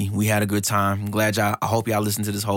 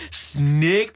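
put-on higher Jamaican-accented call; 4 semitones higher, 3 dB louder than usual. Host repeats Luffy's attack name, Jamaican accent. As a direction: mimic an anime hero's Jamaican accent. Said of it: "like, this Jamaican accent".

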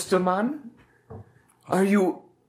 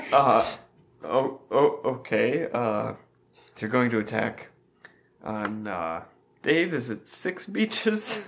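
lower, soft voice for Job; lightly voiced Job; 6 semitones lower, 3 dB quieter than usual. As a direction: play the timid Job. Playing Job character.

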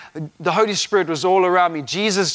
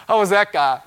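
laughing; 4 semitones higher, 4 dB louder than usual. Real, not acted. Laughing at himself.

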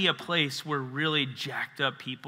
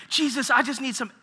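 higher, strained desperate plea; crying out to Jesus; 9 semitones higher, 4 dB louder than usual. Retold or imitated. Miles starts crying and begs Jesus, high and strained. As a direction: beg for help on the edge of tears. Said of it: "He's like".

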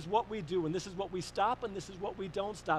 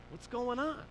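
gentle concern; 6 semitones higher than usual. Retold or imitated. Dad checking on an upset caller, gentle concern. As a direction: carefully ask what is wrong.

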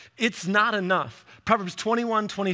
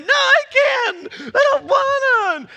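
put-on high, slow, drawn-out child's whine; whiny kid; 8 dB louder, slower than usual. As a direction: whine like a tired kid at bedtime. Kids whining at bedtime.

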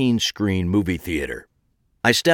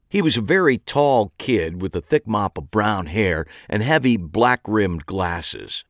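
ordinary delivery

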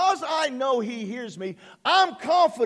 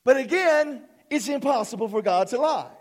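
ordinary delivery

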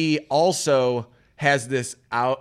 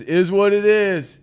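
higher, slow, drawn-out catchphrases; easygoing catchphrase guy; 6 semitones higher, 4 dB louder than usual. Acted. Podcaster imitating the groom who says it over and over, slow high catchphrases. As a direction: stretch each stock phrase out, pitched up. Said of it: "he's the guy who says".

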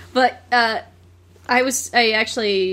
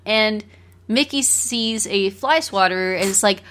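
usual speaking voice

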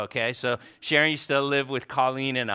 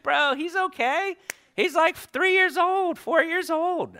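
much higher, hammed-up casual brush-off; mock high-pitched reply. Answering his friend's worry with a much higher, hammed-up voice. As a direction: toss it off in a squeaky mock voice.

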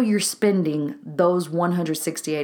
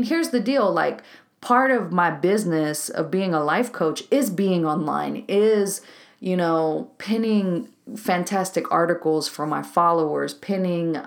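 ordinary delivery

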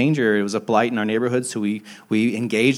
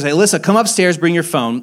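raised, higher voice calling downstairs; mild re-enacted yell for help; retold yell; 6 semitones higher, 7 dB louder than usual. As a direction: call urgently to someone downstairs, retelling it. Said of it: "So I yell down the stairs".

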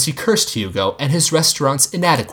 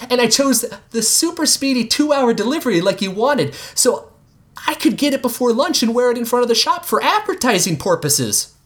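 put-on kid shouting; 12 semitones higher than usual. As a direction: call it out like an excited boy. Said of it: "Jean shouted".

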